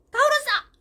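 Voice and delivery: very loud, high-pitched outburst; imitated child's yell. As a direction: mimic a crying child yelling back at mum. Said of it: "이렇게 말하거든요".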